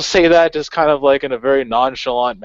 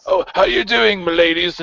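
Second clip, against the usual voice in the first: cheery passerby; 6 semitones higher than usual. Retold or imitated. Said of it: "was like".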